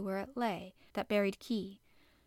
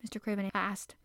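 usual speaking voice

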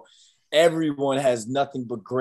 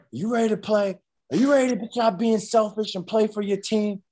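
stern coach; 9 semitones higher than usual. Retold or imitated. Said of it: "Coach Brown was like".

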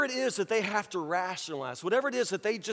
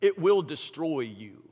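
grave warning; 4 semitones lower than usual. Real, not acted. Preacher warning the congregation about idols, grave. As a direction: warn them gravely, pitch dropped.

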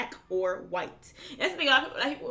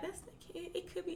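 higher and much softer, wide-eyed wondering; naive young person; 10 semitones higher, 13 dB quieter than usual. Retold or imitated. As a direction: mimic a gullible kid musing. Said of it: "you kinda be like".

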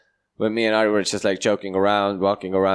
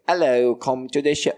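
higher, badly faked native-English accent; attempted native-English accent; 4 semitones higher than usual. Imitating other flyerers to friend, failed English accent. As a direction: have a clumsy go at their English accent. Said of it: "can't do the fucking accent".

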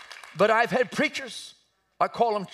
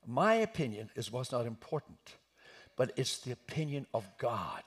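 low, soft, measured reply; calm answer; 9 semitones lower, 8 dB quieter than usual. Shown as a demonstration. Preacher models answering a microphone in the face, calmly. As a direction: drop right down and answer calmly. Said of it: "Just calmly say".

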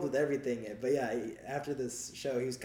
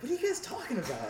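confused; 12 semitones higher than usual. Retold or imitated. Retold confusion.

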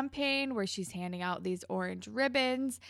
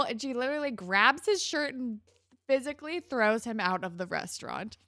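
ordinary talk, nothing marked

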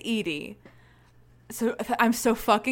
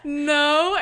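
hammed-up denial; 6 semitones higher, 7 dB louder than usual. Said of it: "And I go".

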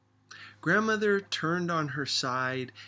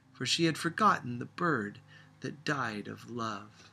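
plain reading voice

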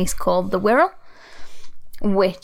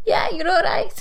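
Scouse yeah you're all right, much higher; bright Scouse accent; Scouse reply; 11 semitones higher than usual. Shown as a demonstration. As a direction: answer like a cheery Scouser. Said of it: "in Scouse".

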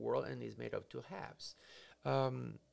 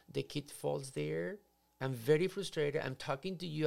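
ordinary delivery

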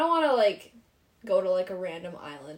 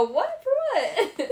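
very high, laughing mock shock; feigned surprise; 3 dB louder, much slower than usual. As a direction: play innocent, squeaky and surprised. Feigning innocence to mom.

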